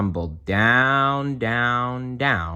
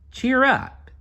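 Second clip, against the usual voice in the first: very high, loud cheer; cheery bear; 4 dB louder than usual. Acted. Cheer Bear comforts Melinda, very high and bright. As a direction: chirp it like a peppy bear.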